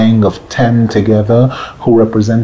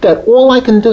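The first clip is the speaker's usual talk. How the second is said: higher-pitched desperate call; yelling; 9 semitones higher than usual. Retold or imitated. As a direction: cry it out from the depths.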